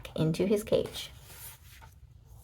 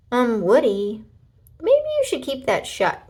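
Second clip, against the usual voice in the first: warning; 4 semitones higher, 5 dB louder than usual. A narrator's reading. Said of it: "warned Buzz".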